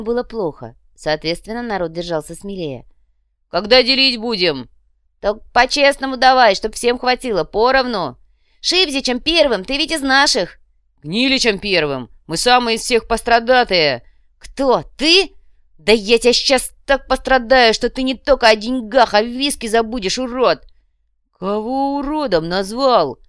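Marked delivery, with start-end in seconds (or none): 3.5-8.2 loud, raised-pitch demand; impatient heckling. A narrator's reading. Crowd clamours to divide the money, heckling loudly. 8.6-23.2 loud, high, indignant shouting; squabbling. A narrator's reading. Rival kinsmen quarrel over the money, shouting threats.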